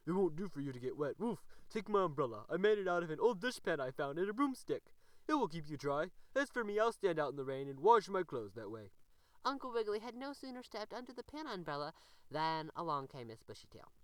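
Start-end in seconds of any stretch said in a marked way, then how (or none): 0.0-8.9 loud, gruff dog voice with woofs; barking tramp dog. A narrator's reading. The tramp dog offers the rabbit his dishpan, loud and barking. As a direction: bark it out friendly and rough. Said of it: "barked the ragged but polite tramp dog".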